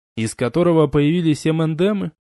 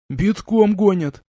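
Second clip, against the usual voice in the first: russian street thug; 6 semitones higher, slower than usual. A narrator's reading. Gopnik answering.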